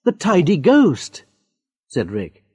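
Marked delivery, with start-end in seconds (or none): 0.0-1.2 higher, slow, knowing emphasis; amused confirmation. Acted. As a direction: confirm it with relish.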